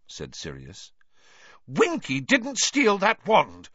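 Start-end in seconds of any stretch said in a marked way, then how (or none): 1.7-3.7 very high, loud, drawn-out protest; shrill. A narrator's reading. Hermione defending Winky against suspicion, shrilly. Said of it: "said Hermione shrilly".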